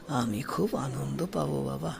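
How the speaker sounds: put-on low, soft, moved old man; a hint of tears held back; teary old man. A narrator's reading. Old man answers the boy with wet eyes, softly moved. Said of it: "ছলছলে চোখ দুটি নিয়ে বললেন".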